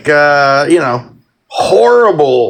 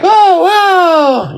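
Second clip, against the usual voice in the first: delighted; 12 semitones higher than usual. Retold or imitated. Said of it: "be like".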